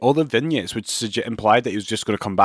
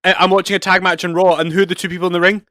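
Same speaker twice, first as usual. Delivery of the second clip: excited; 7 semitones higher, 5 dB louder than usual. Real, not acted. Stunned by a coincidence on TV.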